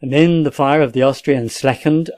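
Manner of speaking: plain reading voice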